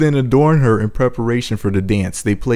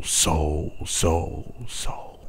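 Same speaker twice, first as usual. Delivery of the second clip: fading echo; 5 semitones lower, 8 dB quieter, much slower than usual. Shown as a demonstration. Narrator demonstrating the cave echo, fading to a whisper. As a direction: echo dying softer each time, ending whispered. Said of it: "I had echoing lower".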